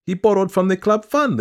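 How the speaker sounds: high, loud woman reporting a grievance; piping complainant's voice; insistent petitioner. A narrator's reading. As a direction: pitch it high and aggrieved.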